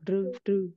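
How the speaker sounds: "rư" with a curled-tongue r; Vietnamese-style r. Shown as a demonstration. Teacher models pinyin r, Vietnamese-like "rư". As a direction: curl the tongue up for "rư". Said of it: "chỉ cần phát âm như bình thường".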